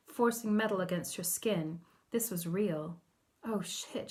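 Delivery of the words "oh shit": soft, breath-caught dismay; gasped. A narrator's reading. Lydia realises she's been blocked, quietly gasped. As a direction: let it out as a quiet gasp. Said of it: "she gasped".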